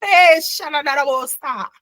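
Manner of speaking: high, playful fake Italian accent; mock Italian accent. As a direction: gibberish in a hammed-up Italian accent. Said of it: "in an Italian accent".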